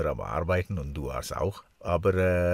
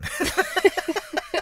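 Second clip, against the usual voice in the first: high, giggling burst of laughter; 5 dB louder than usual. Real, not acted. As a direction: laugh at your own joke. Laughs at his own joke about kissing.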